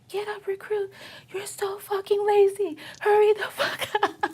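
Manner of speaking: high-pitched mock drill instructor yell. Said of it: "they start yelling at you".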